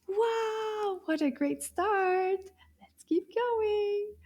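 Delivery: high, slow, drawn-out delight; enthusiastic praise. Real, not acted. Teacher cheers class on after first word, enthusiastic. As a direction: gush encouragement in a high, stretched voice.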